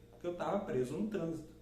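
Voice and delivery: usual speaking voice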